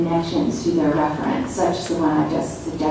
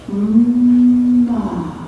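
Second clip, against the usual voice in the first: "Ma" stretched on a long, higher hummed m; very slowly; 5 semitones higher, 4 dB louder than usual. Shown as a demonstration. Author shows how to say it again, "Ma" very slow and sustained. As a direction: draw "Ma" out slowly from a sustained hum. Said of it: "Very slowly".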